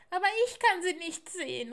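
put-on high, loud, quick child voice; confused owlet cry. Little owl cannot see her mother, confused high cry. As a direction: protest quickly in a squeaky voice. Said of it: "rief die kleine Eule verwirrt".

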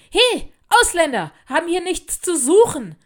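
loud, high-pitched jeer; hostile shouting. A narrator's reading. Bullies chase girl off the playground, shouting. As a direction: yell it across the playground aggressively. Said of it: "riefen sie".

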